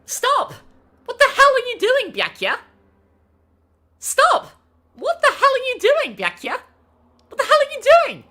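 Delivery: high, full-force yell; loud shout. Acted. Voice actor yelling at Byakuya to stop, high full-force shout. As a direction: shout to stop them, dropping on the name. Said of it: "That's definitely gonna peak".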